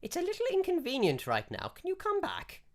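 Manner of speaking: put-on high, fluttery old lady, politely brushing him off; a very high, dithering elderly priestess.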